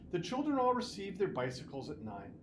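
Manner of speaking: usual speaking voice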